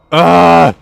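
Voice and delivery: very loud, high cry; exasperated scream; frustrated yell. Real, not acted. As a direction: let out an angry roar after dying. Just died in game.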